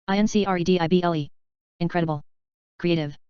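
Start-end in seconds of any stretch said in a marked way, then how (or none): 0.1-1.3 letters rattled off fast; quick spelling. Shown as a demonstration. Teacher spelling the word fast for the class. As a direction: run through the letters briskly. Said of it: "spelling drill".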